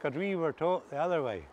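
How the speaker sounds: usual speaking voice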